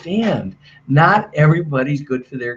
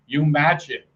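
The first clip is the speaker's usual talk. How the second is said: ordinary delivery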